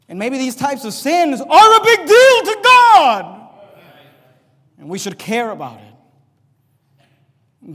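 loud, slow pulpit swell, then dropping; preaching at full force. Real, not acted. Pastor driving home his point to the church, preaching slowly and loudly. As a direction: build the point and let it fall.

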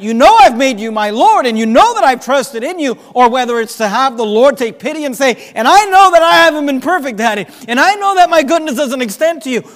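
loud, rising preacher's cry, hammering each although; full-force preaching. Acted. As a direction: preach it out, pleading for the congregation. Voicing the desperate prayer again.

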